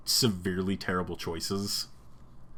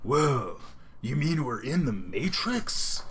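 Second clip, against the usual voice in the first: slow, amazed dude impression; Keanu Reeves; 4 semitones higher, 3 dB quieter, slower than usual. Fan mimicking an actor who only plays himself, slow and amazed. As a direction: stretch it out, a little higher, dumbfounded. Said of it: "Keanu Reeves is just like".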